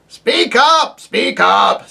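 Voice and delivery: put-on high, loud querulous old dwarf; deaf old dwarf. Deaf dwarf to the owl, loud querulous old man. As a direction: bark it like an old man hard of hearing.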